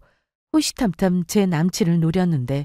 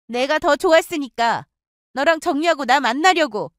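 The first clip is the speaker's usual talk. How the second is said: higher, smug and gloating; smug friend boasting the ex chose her; 8 semitones higher than usual. Acted. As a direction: rub it in.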